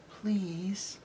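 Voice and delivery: very soft, whispered plea; timid, trembling horse. A narrator's reading. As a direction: plead in a tiny hushed voice. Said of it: "shaking all over".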